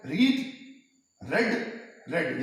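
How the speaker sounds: रीड long ee, then रेड short e twice; read versus red. Shown as a demonstration. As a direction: contrast रीड and रेड clearly. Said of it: "pronunciation में अंतर है". (रीड लंबी ई, रेड छोटा ए)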